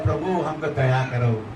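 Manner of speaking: a soft low murmured plea for mercy; quiet plea. Retold or imitated. Preacher mimicking a believer's weak prayer, soft and low. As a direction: mutter it timidly, barely asking. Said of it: "कोई भी नहीं पुकारता है".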